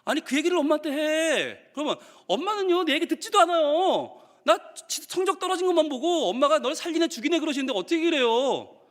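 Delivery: high, aggrieved kid impression; protesting child. Child refusing to confide in mom, aggrieved. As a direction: protest as if nobody ever listens. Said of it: "막 이렇게 나와요".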